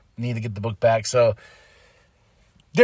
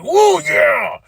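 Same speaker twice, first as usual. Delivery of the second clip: excited cheering; 10 semitones higher, much slower than usual. Real, not acted. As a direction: whoop it like in the arena. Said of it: "I was like".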